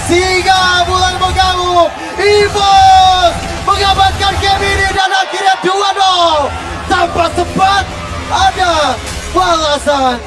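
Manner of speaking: slow, drawn-out victory shout; fighting game announcer. Real, not acted. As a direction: call the win like an arena announcer. Game-winning hype call.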